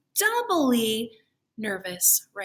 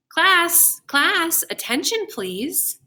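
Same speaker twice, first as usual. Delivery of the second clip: loud, high call for attention; calling the class; 8 semitones higher, 7 dB louder, slower than usual. A narrator's reading. Principal calling the class to attention, loud and high. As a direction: call a noisy room to order. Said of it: "said Missus Burton".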